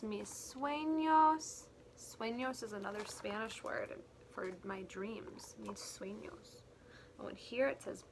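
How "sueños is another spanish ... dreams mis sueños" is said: whisper. Acted.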